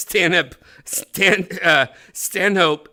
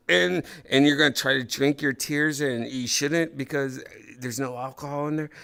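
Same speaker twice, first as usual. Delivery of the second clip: ordinary talk, nothing marked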